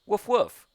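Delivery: barking. Pretend. Barking at the game dog.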